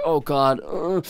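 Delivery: reliving nervous dread, pitch and volume up; retold panic. Writer watching others scan their story, retold panic. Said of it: "I'm terrified of what other people".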